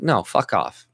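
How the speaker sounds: ordinary talk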